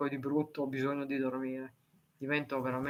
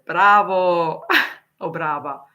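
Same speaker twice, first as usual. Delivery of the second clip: much louder, a burst of Bravo; excited praise; 2 semitones higher, 12 dB louder, slower than usual. Real, not acted. Praising a viewer who writes many languages, loud cheer. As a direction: cheer them on loudly.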